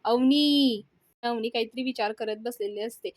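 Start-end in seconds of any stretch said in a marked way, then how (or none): 0.0-0.8 loud, drawn-out call of a name. A narrator's reading. Vivan yelling for Avni outside, loud call. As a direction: holler for someone inside the house. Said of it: "म्हणून ओरडायला लागतो".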